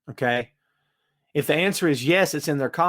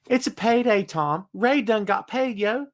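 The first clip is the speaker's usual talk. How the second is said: excited; 7 semitones higher than usual. Real, not acted. Gleeful reveal of payday.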